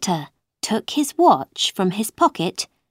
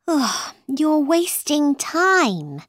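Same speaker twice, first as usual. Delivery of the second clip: slow, high, exasperated sigh; 8 semitones higher, slower than usual. A narrator's reading. Alice to the Hatter about his riddles, sighing. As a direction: sigh and draw the words out. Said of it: "Alice sighed again".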